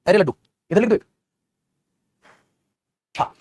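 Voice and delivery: ordinary talk, nothing marked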